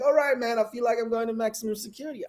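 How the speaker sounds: high-pitched retold grumble; protesting traveller. Traveller answering the shouting US officer, high retold protest. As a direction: push back in a lifted, put-upon voice. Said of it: "I'm like".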